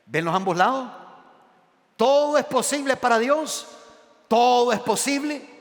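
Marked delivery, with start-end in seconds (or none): 2.0-5.5 loud, high proclamation; preaching shout. Real, not acted. Preacher declaring to the congregation: loud, high proclamation. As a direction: ring it out to the whole church.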